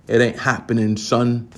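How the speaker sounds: usual speaking voice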